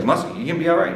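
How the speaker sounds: high-pitched concerned question; imitated worried check. Crew checks on nervous-looking starter.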